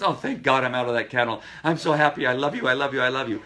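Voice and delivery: high, loud, gushing dog voice; happy dog's voice. Retold or imitated. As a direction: voice a dog overjoyed to leave the kennel. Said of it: "the dog goes".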